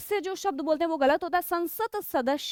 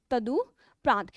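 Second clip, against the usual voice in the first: slow spelled-out word; 4 semitones lower, much slower than usual. Shown as a demonstration. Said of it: "शुद्ध शब्द होता है आपका तदुपरांत".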